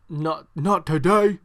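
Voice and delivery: mock-furious slow not, not today; pissed retort. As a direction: drag out not, not today like an angry wrestler. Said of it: "he was just pissed".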